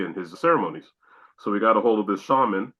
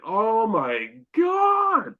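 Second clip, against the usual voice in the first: high, slow, stretched-out oh my God; drawn-out awe; 12 semitones higher, much slower than usual. Retold or imitated. Man recalling his vision, slow stretched awe. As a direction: let it hang in disbelief.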